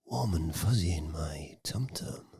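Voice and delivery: soft mock-cute whisper. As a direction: whisper it like a sweet nothing. Mock cute ASMR reaction.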